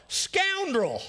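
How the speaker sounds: high, mocking scorn; scornful. Real, not acted. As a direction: sneer the word out. Mock scorn at Diotrephes.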